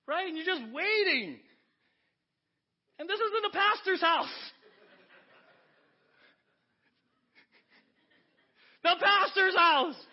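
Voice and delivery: high, loud preaching climb; preaching at full force. Acted. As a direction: drive it home from the pulpit.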